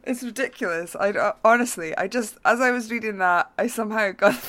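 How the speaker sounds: words squeezed through giggles; giggly explanation; laughing while explaining. Real, not acted. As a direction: try to talk through the laughter. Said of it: "try not to laugh".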